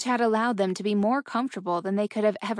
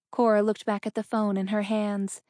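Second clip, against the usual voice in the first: usual speaking voice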